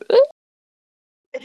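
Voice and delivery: high, squeaky imitation of a voice crack; cracking yell. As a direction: mimic a yell that breaks upward. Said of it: "when he yells, like, his voice goes".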